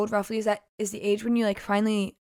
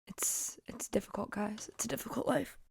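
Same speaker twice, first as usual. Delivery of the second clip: unvoiced mock-dramatic whisper; playful whisper to viewers about a hard life; 13 dB quieter than usual. Real, not acted. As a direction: whisper it as if it is a tragedy. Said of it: "mock whisper of struggle".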